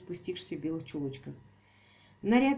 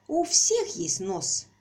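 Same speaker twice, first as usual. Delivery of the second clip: haughty, as to a simpleton; 6 dB louder, much slower than usual. A narrator's reading. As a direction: explain it like to a dimwit. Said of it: "высокомерно, как слабоумному, объяснила Пупси".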